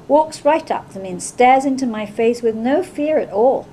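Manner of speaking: ordinary delivery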